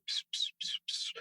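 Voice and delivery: very soft whispered chirps; tiny hushed tweets of a dazed head; repeated voiceless little bird noises; imitated bird sounds. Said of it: "I hear birds".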